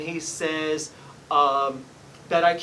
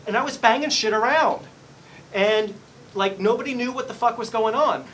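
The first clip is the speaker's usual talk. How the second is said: raised voice; raised channelled rant; 7 semitones higher, 7 dB louder than usual. Retold or imitated. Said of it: "He says".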